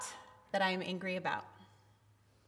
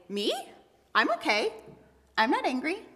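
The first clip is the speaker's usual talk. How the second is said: high, rising, mock-innocent denial; pretending not angry; 7 semitones higher than usual. Someone hiding anger from others, falsely cheerful denial. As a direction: protest lightly that all is fine, pitched up. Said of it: "very easy to pretend".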